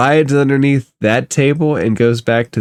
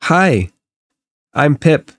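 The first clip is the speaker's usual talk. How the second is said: lightly voiced small boy, higher pitch; little boy; 4 semitones higher than usual. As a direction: introduce yourself with a hint of a child's voice. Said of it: "And he says".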